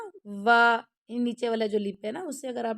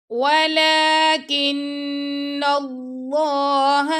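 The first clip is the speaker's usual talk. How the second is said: slow, melodic tajweed chant; teacher chanting a verse for students; Quran recitation; 4 semitones higher, 5 dB louder, much slower than usual. Shown as a demonstration. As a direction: recite it slowly with full tajweed.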